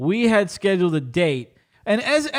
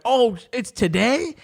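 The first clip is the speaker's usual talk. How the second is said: drawn-out, higher surprised question; retold surprise; 5 semitones higher, slower than usual. Woman realising the date is today, retold surprise. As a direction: act out her slow realisation. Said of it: "she was like".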